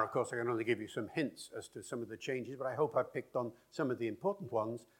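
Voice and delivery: ordinary talk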